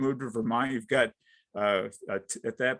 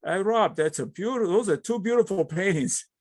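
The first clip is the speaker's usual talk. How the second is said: put-on light German accent, higher and louder; a hint of a German friend's accent, raised and loud; 9 semitones higher, 4 dB louder than usual. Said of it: "he's got a very thick German accent".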